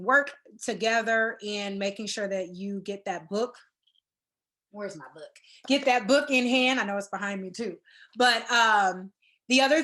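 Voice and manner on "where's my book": low, soft aside to oneself; quiet aside. Real, not acted. Presenter looking for their book mid-talk, quiet aside. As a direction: drop right down, as if talking to yourself.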